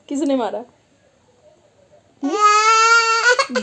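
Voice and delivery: loud, high coaxing questions; mother soothing loudly over crying. Real, not acted. Mother comforting crying child.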